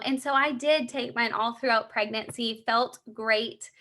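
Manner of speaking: ordinary talk, nothing marked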